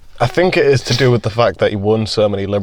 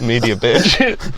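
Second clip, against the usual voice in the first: mock press taunt; 5 dB louder, much slower than usual. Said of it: "just to be like".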